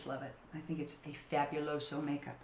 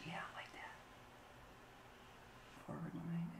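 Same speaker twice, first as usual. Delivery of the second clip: whispering; 17 dB quieter than usual. Real, not acted. Muttering to herself while drawing brows.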